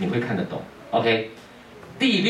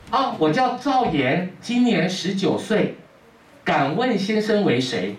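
put-on higher, slow young man's voice; a naive youth answering politely, higher and slow; young farmer; 8 semitones higher, slower than usual. Young farmer answering Guan Lu politely in a higher, slow voice.

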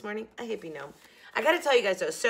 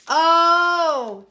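much higher, louder and slowed with delight; excited; 11 semitones higher, 13 dB louder, much slower than usual. Real, not acted. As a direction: squeal out the lucky draw. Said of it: "Ooh, I'm excited".

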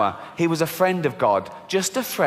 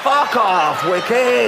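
mock outrage; 5 semitones higher, 4 dB louder, much slower than usual.

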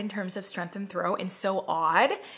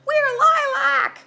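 much higher, very loud, slow shout; hysterical lilac; 10 dB louder, much slower than usual. Retold or imitated. Lilac scent personified as a shrieking diva. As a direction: scream it like an overwrought flower. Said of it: "They're like".

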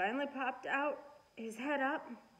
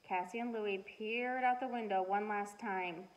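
usual speaking voice

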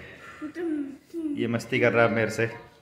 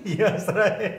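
laughing; 8 semitones higher than usual. Real, not acted. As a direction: giggle through the words. Laughing at baby.

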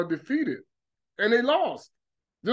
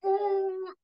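high ooh; 11 semitones higher, 3 dB quieter than usual. Retold or imitated. Water coming everywhere.

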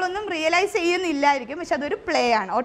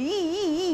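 brushing sound; 3 semitones higher, 4 dB quieter, slower than usual. Shown as a demonstration. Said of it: "അതിൻ്റെ കൂടെ sound imitate ചെയ്യുന്നുണ്ട്".

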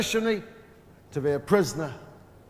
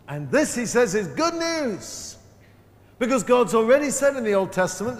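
forceful preaching; 3 semitones higher, 4 dB louder, slower than usual. Acted. Preaching the mystery of Paul.

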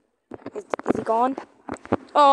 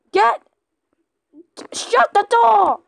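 high, loud frantic yell; panicked shout; 8 semitones higher, 6 dB louder than usual. Real, not acted. Scared player yelling at the team to shut the door.

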